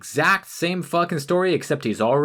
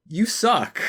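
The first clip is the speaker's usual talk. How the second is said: normal talking voice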